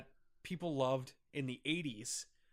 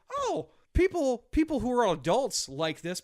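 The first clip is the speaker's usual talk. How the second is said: exaggerated eureka, louder than usual talk; mock realization; 7 semitones higher, 6 dB louder than usual. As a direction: act out a studio's bright idea. Voicing studio's marketing idea.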